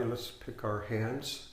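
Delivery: ordinary talk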